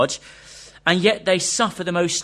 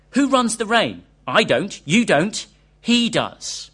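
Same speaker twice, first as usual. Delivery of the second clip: impassioned Templeton; 6 semitones higher, 5 dB louder, slower than usual. Acted. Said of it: "he implored as he got more animated".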